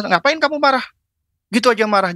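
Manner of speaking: high-pitched, dismissive mock scolding. Parent wrongly dismissing a child's anger, scolding. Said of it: "Jangan langsung kita".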